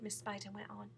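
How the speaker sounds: usual speaking voice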